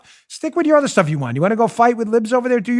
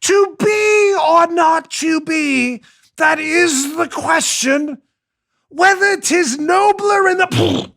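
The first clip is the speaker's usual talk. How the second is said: hammy Hamlet; 9 semitones higher, much slower than usual. Acted. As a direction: boom it out like a bad Shakespeare actor. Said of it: "So they're doing Hamlet".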